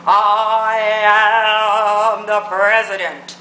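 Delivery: hammed-up, booming slow presidential send-up; mock presidential, exaggerated. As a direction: stretch "I am" out grandly, mocking the president. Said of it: "saw what the president said".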